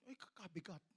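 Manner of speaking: low, quiet strained grunt of effort; straining under weight. Acted. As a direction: heave the words out under a heavy load. Acting out lifting heavy cross. (hirap sa bigat)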